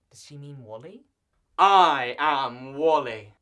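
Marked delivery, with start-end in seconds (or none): 1.6-3.3 slow, very loud proclamation; mock booming declaration. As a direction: boom it out slowly, word by word. Sudden booming answer to Waldo question.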